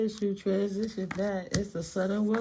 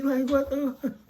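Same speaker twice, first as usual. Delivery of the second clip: laughing disbelief; 8 semitones higher than usual. Real, not acted. Laughing at her own nightmare in disbelief.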